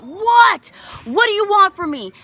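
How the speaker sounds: high, loud exasperated shout; acted outburst of angry desperation; screaming at God. Grieving mother answering God's call, high angry yell. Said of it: "I was screaming at God".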